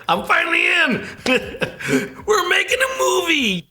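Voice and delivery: high, loud laughing shout; excited shout. Retold or imitated. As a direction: burst out with joy. Excited first movie.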